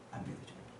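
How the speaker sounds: whispered 안 믿어주는 거예요. Shown as a demonstration. Preacher whispering to the congregation. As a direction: breathe 안 믿어주는 거예요 without voice.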